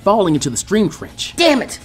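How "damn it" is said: a sharp curse, higher; voiced Ino cursing as she falls; mild narrated yell. A narrator's reading. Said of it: "she yelled out".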